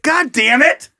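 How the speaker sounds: a frustrated, rising "god damn it"; exasperated. Real, not acted. To the cohost who won't click, exasperated. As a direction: throw up your hands at the cohost.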